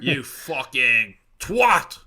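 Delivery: put-on slow, loud rough cockney accent; cockney riffraff. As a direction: drag out the insult like a cockney lout. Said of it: "a classless type of riffraff Englishman".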